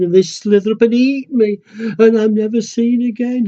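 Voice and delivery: high, loud and fearful little voice; plead, building your fears; scared little penguin. Acted. Little penguin begs his mummy, scared of the sea.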